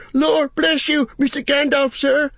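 very high, loud startled gardener; flustered servant Sam. Acted. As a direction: blurt respectfully in a high character voice. Sam caught, answers wizard.

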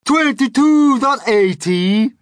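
very high, loud, slow shriek. A narrator's reading. Earthworm corrects the lying Centipede, shrieking. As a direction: shriek the correction as an outraged worm. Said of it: "shrieked the Earthworm".

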